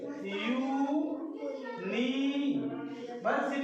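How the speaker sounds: "यूनिवर्सिटी" drawn out very slowly, yoo sound first; slow pronunciation demo. Shown as a demonstration. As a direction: spell out "यूनिवर्सिटी" syllable by syllable, very slow. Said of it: "देखिए". ("यूनिवर्सिटी" बहुत धीरे, हर अक्षर खींचकर)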